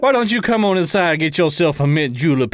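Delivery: a hint of a genteel Savannah salesman on the porch; Southern gentleman salesman. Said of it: "How come nobody talks like that anymore?".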